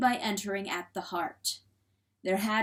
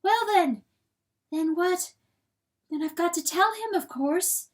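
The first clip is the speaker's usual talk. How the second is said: high-voiced hen working it out; musing to herself; 9 semitones higher, 3 dB louder than usual. A narrator's reading. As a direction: think aloud in a higher voice. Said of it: "as though speaking to herself".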